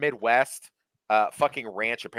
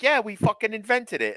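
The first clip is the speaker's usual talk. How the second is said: mock British snark; 12 semitones higher than usual. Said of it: "They're like".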